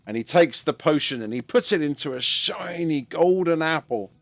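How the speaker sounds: usual speaking voice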